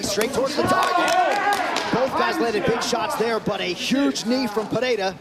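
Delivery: high, loud excited call. Real, not acted. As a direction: suddenly shout over the crowd. Commentator reacts to big knee.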